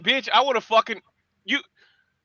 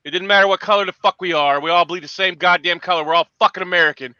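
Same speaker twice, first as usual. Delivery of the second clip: usual speaking voice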